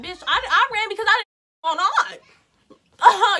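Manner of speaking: loud, high, half-laughing protest; indignant retort. Retold or imitated. Answering friends who asked why they ran, indignant retort. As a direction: raise voice and pitch in mock outrage.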